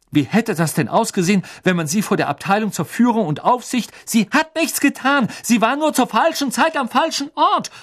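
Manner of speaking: very high, loud, furious retort; furious outburst. A narrator's reading. Girl snapping furiously at pompous Percy, furious. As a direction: cut him off in fury. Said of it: "fauchte Hermine".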